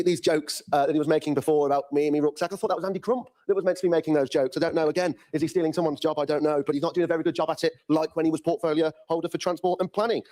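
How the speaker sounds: ordinary delivery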